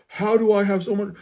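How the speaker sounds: whiny disbelief from a confused dad; mock dad. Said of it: "And he's like".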